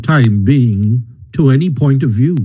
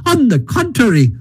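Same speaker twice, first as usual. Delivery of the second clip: very high, gleeful character voice; defiant enthusiasm, climbing in pitch; emphatic Toad; 13 semitones higher than usual. A narrator's reading. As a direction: promise mischief with rising excitement. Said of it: "replied Toad emphatically".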